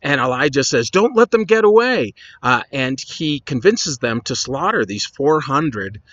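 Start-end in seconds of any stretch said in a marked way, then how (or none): 0.9-2.1 high-pitched call to a crowd; acted urgent call. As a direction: call out an order as Elijah. Elijah orders the people, acted.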